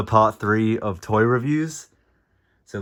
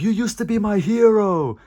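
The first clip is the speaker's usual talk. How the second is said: mock anguished cry; 11 semitones higher than usual. Fan crying to his childhood hero mid-fight, mock anguish. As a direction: wail it like a fan crushed by his idol.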